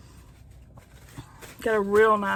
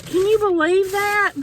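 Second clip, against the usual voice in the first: incredulous; 10 semitones higher, 4 dB louder than usual. Real, not acted. No napkins given, exasperated.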